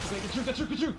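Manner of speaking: urgent high pleading; willing it to get through; panicking gamer. Real, not acted. Squeezing past enemy.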